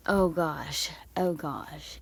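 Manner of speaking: breathy whisper of disbelief; whispered oh gosh. Real, not acted. A stunned creator whispers to herself, watching the clip.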